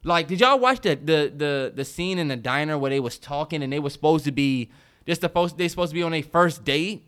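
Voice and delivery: usual speaking voice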